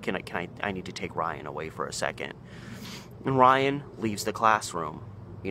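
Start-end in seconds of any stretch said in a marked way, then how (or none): 0.0-2.3 quiet and discreet, trying not to draw attention; hushed principal. Retold or imitated. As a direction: murmur it low so the class won't notice. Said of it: "trying to get as little attention as possible".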